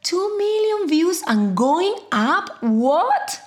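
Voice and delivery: loud surprised outburst, rising pitch; amazed. Acted. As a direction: blurt it out in disbelief. Discovers viral video views.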